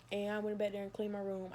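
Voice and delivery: ordinary talk, nothing marked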